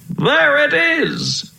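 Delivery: high, drawn-out call of discovery; young prince calling out in a high voice; excited cry. A narrator's reading. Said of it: "he cried".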